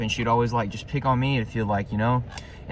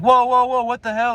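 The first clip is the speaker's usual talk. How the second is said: much higher, startled retold protest; alarmed protest; 12 semitones higher, 5 dB louder than usual. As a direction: burst out loud and high, then drop the volume. Retold alarm as teacher touches them.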